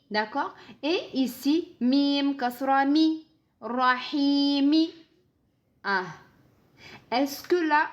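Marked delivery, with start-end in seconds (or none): 1.8-4.9 slow chanted syllables; slow recitation. Shown as a demonstration. Teacher modelling the word without a stop. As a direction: draw out "Rahimi" like a reciter. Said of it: "spells and recites slowly".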